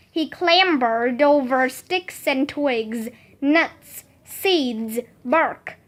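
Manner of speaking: ordinary delivery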